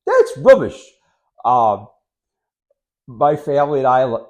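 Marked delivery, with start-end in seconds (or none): 0.1-0.8 higher and louder, a playful British "That's rubbish"; a hammed-up UK "That's rubbish"; mock British accent. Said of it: "They use the word, the word rubbish a lot".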